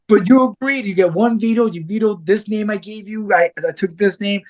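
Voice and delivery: high, whiny mock protest to his wife. Protesting wife's veto.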